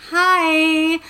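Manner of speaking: high, loud sugary hi; mock-polite chirpy hello; fake sweet greeting. Mom greeted sweetly by [name].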